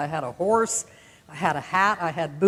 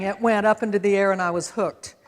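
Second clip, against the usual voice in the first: usual speaking voice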